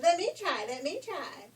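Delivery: high, loud eager begging. Acted. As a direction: beg loudly and eagerly. Youngest sister begs.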